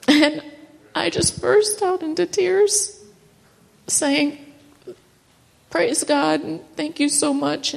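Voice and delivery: high, choked-up voice of happy tears; crying through the words; tearful joy. Real, not acted. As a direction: voice breaking with relief. Retelling the good news call.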